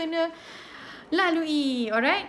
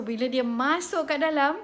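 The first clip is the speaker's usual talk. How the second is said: ordinary talk, nothing marked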